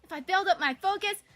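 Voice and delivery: high, loud hyped-up yell; yelling tennis girl. Acted. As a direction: pump yourself up at full volume. Voicing Tenko hyping herself up.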